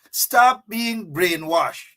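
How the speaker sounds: drawn-out command, each word apart; slow emphatic command. Shown as a demonstration. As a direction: hammer it home slowly.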